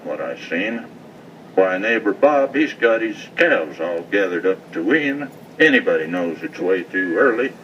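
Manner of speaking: rhythmic cowboy poem reading; poem recitation. Acted.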